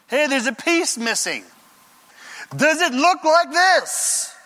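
high, loud, drawn-out holler; calling from a distance. Acted. David calling to Saul from far off, shouting. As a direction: shout across a wide gap. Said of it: "David, a goodly distance away".

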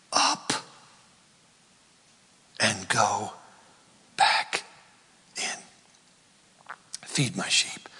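soft, broken-up hushed phrases; God restoring Peter, whispered; gentle whisper. Acted. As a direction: coax him back softly.

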